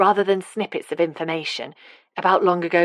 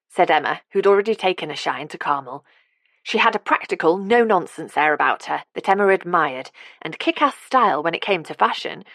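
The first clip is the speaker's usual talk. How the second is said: usual speaking voice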